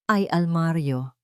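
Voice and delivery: usual speaking voice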